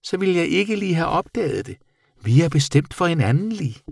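high little creature voice; affectionate bat. A narrator's reading. Bat telling the boy they belong together, high voice. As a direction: declare friendship high-pitched. Bat affirms their friendship.

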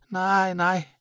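high, thin-pitched creature voice; reassuring bat. A narrator's reading. Bat assuring the boy her eyes are fine, high voice. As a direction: answer high and unforced.

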